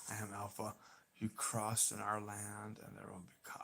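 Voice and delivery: very soft, tentative run-through of an impression; Alpha. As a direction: try the villain's line under your breath, trailing off. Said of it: "I'm gonna see if I can do this".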